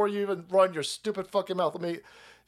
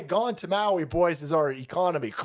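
normal talking voice

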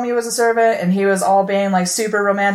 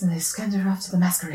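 whispering; 5 dB quieter, faster than usual. Real, not acted. Whispered correction of friend.

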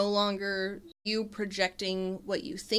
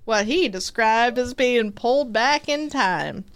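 put-on Southern accent; 3 semitones higher, 5 dB louder than usual. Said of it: "Your voice is so southern".

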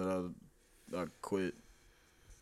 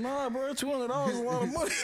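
mock coworker voice; 3 dB louder than usual. Quoting coworker mockingly.

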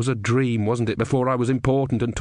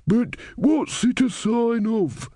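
put-on higher-pitched, slow old man; elderly man; 12 semitones higher, much slower than usual. A narrator's reading. Elderly man questioning the crowd about the sign, puzzled old voice. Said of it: "said an elderly man".

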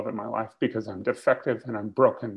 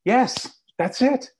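high, loud, eager yes yes; a delighted high-pitched yes; excited yes; 11 semitones higher, 3 dB louder, much slower than usual. Retold or imitated. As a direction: jump at the recognition, thrilled. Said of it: "I said".

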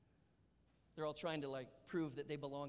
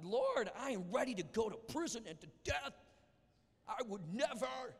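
earnest Peter; 7 semitones higher, 5 dB louder than usual. Acted. Peter's vow to Jesus.

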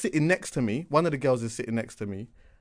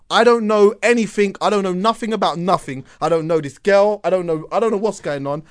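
raised, louder voice, insistent denial; protesting innocence; 5 semitones higher, 7 dB louder than usual. Retold or imitated. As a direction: plead total ignorance to everyone asking. Acts out denying all involvement.

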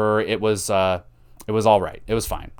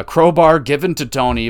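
normal talking voice

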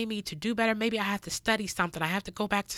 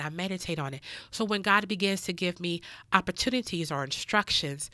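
ordinary talk

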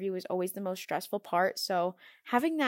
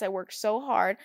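ordinary talk

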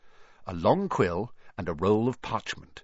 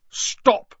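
loud, high sharp order; forceful raised-voice order; commanding; 13 semitones higher, 8 dB louder than usual. A narrator's reading. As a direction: command him to halt. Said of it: "he commanded".

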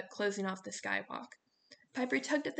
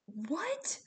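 sharp demand; 8 semitones higher than usual. A narrator's reading. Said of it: "Leo demanded".

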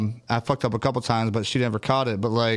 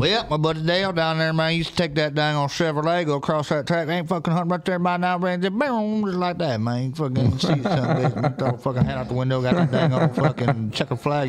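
higher-pitched, rambling country buddy character; good-old-boy racing yarn-spinner; 4 semitones higher than usual. Acted. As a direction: ramble excitedly like a backwoods racing fan.

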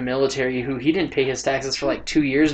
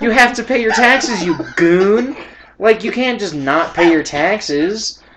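exasperated telling-off; 7 semitones higher, 4 dB louder, slower than usual. Retold or imitated. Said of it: "And I'm like".

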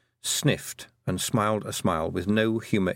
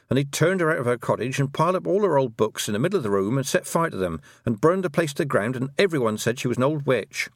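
higher, breathless-paced run of clauses; rushed outpouring; 6 semitones higher, 3 dB louder than usual. A narrator's reading. Girl pouring out the villagers' cruelty, in a rush. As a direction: let it all tumble out, clause upon clause. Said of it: "it all came out in a rush".

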